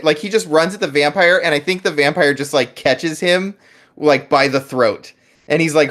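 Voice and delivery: ordinary delivery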